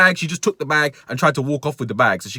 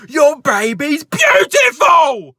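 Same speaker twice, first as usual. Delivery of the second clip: much higher and louder, slow furious yell; angry shouted compliment; 12 semitones higher, 8 dB louder, much slower than usual. Retold or imitated. Shoplifter yells at the dad about his baby, furious shout. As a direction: bellow the compliment like an insult. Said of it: "giving compliments while shouting".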